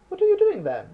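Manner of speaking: high, loud, startled Cuban man's question. Acted. Nervous Cuban man asking what L is doing, in a high loud voice. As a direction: jump up high and loud at him. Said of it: "The Cuban questions".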